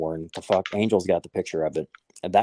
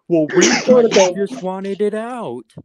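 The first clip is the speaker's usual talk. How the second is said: mock worker voice; 12 semitones higher, 7 dB louder than usual. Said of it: "worker's reply".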